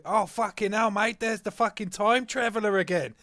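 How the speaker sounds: mock astonished outburst; Aussie Man imitation. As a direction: exclaim it like an amazed video reviewer. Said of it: "he kept bringing this one clip back into it, going".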